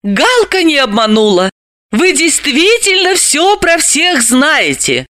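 impressed, high-pitched heroine; admiring praise. A narrator's reading. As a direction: gush with praise, voice lifted high. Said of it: "восхищённо похвалила его".

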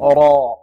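را said thick and full; heavy ra. Shown as a demonstration. Teacher isolating the syllable, heavy ra. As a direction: velarize the ر in را. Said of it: "تفخيم".

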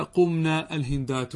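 قمنا slow, held -naa, apart from الهندات; rejected plural nun. Shown as a demonstration. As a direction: stretch the ending of قمنا before الهندات. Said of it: "هذه هي لغة أكلوني البراغيث". («قمنا الهندات» ببطء مع مدّ النون)

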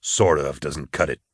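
low-pitched sneer from a bounty hunter. A narrator's reading. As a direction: dismiss him with low contempt. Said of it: "Nail sneered".

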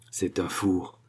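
a quiet inner mutter, deflated; muttered to himself. Acted. Old actor judging the audition to himself, muttering. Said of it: "se disait-il intérieurement".

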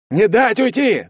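high, loud shouted order; крик. A narrator's reading. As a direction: shout it to the whole squad. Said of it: "закричал Карасик". (громкий крик командира)

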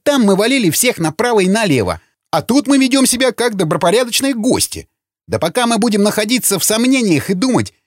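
high-pitched, insistent argument; heated tirade. Acted. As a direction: press the case with agitated urgency. Hothead presses his angry argument. (горячий спор высоким голосом)

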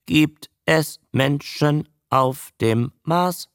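monotonous beginner reader, each word separate; monotone spelling voice. A narrator's reading. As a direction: read laboriously in one unvarying tone. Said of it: "buchstabierte die eintönige Stimme".

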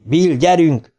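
high urgent call; mild narrated shout. A narrator's reading. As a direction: call him to hurry. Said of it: "kiáltotta Ben".

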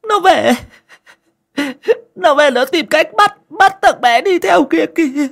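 put-on high, loud whimpering old mother's voice; a mother pleading through sniffles, pitched far up; high whining old woman's voice; sniffling old mother. A narrator's reading. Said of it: "mà sụt sùi".

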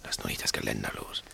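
tonlos geflüstert; flüstern. Acted. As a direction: ganz leise, kaum hörbar. Schleichen zur Tür hinauf. (flüsternd kurz vor der Tür)

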